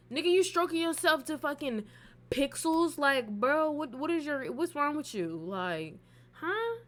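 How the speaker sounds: high, loud disbelieving outburst; high-pitched what-is-wrong-with-you rant; incredulous. Retold or imitated. Retells her incredulous reaction to him.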